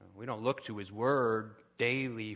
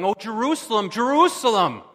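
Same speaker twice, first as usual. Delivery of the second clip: loud, much higher grieving cry; Jesus lamenting; 8 semitones higher, 12 dB louder than usual. Acted. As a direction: cry out over the city. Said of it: "Jesus standing in Jerusalem going".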